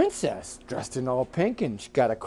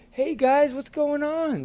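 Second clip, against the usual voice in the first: high-pitched friendly greeting; chirpy female frog voice; girl bullfrog; 13 semitones higher, slower than usual. Acted. Girl bullfrog greeting the boys, high and friendly.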